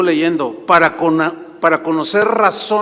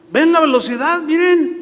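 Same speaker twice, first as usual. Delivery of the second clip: quoting the cop; 10 semitones higher, 3 dB louder than usual. Retold or imitated.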